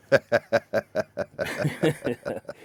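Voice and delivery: long real laugh. Real, not acted. Driver laughing at own story, long laugh.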